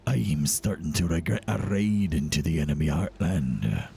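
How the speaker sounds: low, slow pirate captain voice. Acted. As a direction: growl it like an old sea captain. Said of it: "a pirate voice for this".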